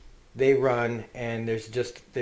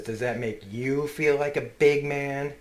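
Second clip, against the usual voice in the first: menacing taunt; 4 semitones higher, 3 dB louder than usual. Retold or imitated. Father turned monster taunts son.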